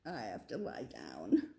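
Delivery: weak whisper with a stomach ache; sick whisper. Acted. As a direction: breathe it out, too sick to talk. Stomach ache, going to lie down.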